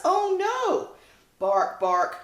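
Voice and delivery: high loud yelp of fright; puppy alarm. Acted. Puppies seeing the bird come at them, alarmed.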